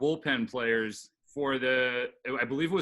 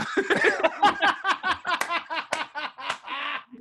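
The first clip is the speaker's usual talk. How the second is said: loud laugh; 5 dB louder than usual. Real, not acted. Laughing at the story's punchline.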